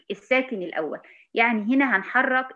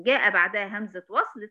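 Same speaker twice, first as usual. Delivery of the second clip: ordinary talk, nothing marked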